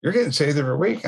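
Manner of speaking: quick, high mock disbelief; imitating incredulous lady. Church lady to repeat convert, imitated incredulity. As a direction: pitch jumps, hurried mimicked complaint.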